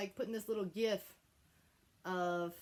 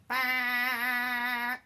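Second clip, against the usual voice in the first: foghorn bah; 7 dB louder than usual. Shown as a demonstration. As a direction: blast it like a foghorn. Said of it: "I'm thinking, like".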